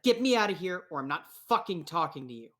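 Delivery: fast, heated threat; angry ultimatum. Acted. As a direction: fire it off impatiently. Jailed character threatens on phone.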